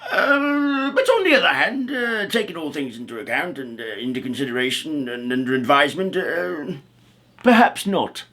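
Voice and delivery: teasing wizard. Acted. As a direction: toy with him, then refuse. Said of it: "replied the wizard".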